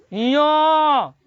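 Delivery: यो sounded out from the palate; palate sound demo. Shown as a demonstration. Teacher to pupils, sounding out a palate letter. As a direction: say यो clearly from the palate. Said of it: "तालु से जो निकलता है".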